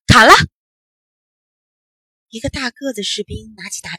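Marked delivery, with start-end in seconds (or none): 0.1-0.3 shouted command. A narrator's reading. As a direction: shout the execution order. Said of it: "大官儿喊了一声". (大声喝令)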